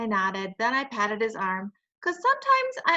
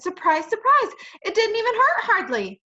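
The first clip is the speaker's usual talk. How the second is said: high, louder delighted surprise; excited; 11 semitones higher, 7 dB louder than usual. Acted. Junie finds the shoe does not hurt, high excited voice. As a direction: marvel out loud.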